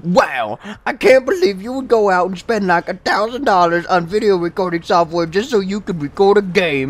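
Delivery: high, loud mock outrage; imitated pissed-off kid. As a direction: mimic an angry kid's disbelief. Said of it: "he got all pissed off".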